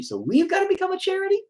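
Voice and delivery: squeaky disbelieving rise; incredulous question. Retold or imitated. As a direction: play it like you can't believe the logic. Mocking his own baffled question.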